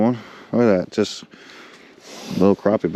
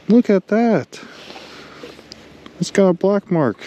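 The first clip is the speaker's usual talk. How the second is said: much higher, thrilled squeals over a rare fish; excited; 10 semitones higher, slower than usual. Real, not acted. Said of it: "I'm getting excited about crappie".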